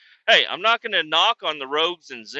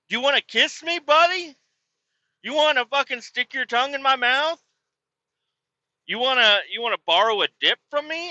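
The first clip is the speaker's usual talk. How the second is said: mocking angry rant; 9 semitones higher than usual. Taunting the absent guy who snubbed him, mocking anger. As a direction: taunt him like he's standing right there.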